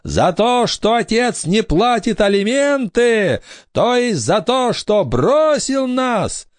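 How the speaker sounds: high, slow wailing reproach; ghostly accusation. A narrator's reading. Ghost girl blames absent father, high reproachful wail. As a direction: draw out the grievances like a wronged spirit.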